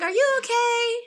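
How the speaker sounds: high, gently concerned voice of the receptionist. Retold or imitated. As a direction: receptionist checking on a stressed patient, gently concerned. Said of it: "And she looking like".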